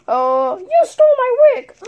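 high loud accusation; mock accusing. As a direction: call out the thief. Confronting the thief.

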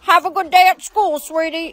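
put-on sweet old lady voice; grandma voice. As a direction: play a doting grandma.

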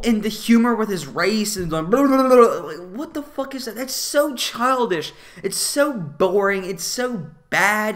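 high-pitched scoffing disbelief; mock the humour in disbelief; exasperated rant. Real, not acted. Exasperated rant about Jar Jar.

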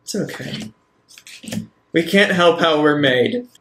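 ordinary delivery

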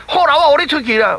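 high, loud giving in; relenting shout. Acted. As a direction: finally let him go, exasperated. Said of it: "三角鱼讲".

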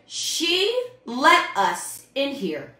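slow, loud, drawn-out demon answer; demon's reply. Retold or imitated. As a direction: imitate a demon declaring it word by word. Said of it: "the demons would reply".

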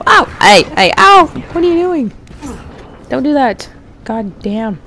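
startled protest. Real, not acted. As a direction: yelp and protest at the hit. Being hit in game.

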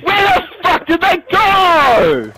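high, drawn-out wailing rage; angry shout. Real, not acted. Player raging at teammates to put him down, angry shout. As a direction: yell slowly, in real exasperation.

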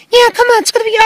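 fast, high, eager; excited high voice. Acted. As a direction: rush it out with glee. Eager character voice.

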